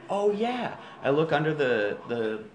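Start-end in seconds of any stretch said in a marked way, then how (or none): 0.1-0.8 realizing. Retold or imitated. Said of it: "And I went".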